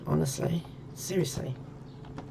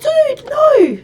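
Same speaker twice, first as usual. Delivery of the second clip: very high, loud yelled dude no; alarmed yell; 15 dB louder than usual. Retold or imitated. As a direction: yell it to stop him. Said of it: "I was gonna go".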